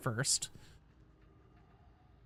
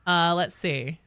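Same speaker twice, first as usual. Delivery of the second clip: ordinary delivery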